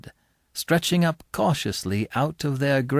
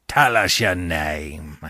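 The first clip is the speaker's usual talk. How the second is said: gruff convict voice; 9 semitones lower, slower than usual. Acted. Convict threatens boy in graveyard.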